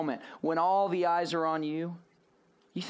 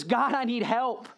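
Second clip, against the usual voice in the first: pleading prayer; 5 semitones higher than usual. Retold or imitated. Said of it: "and he says".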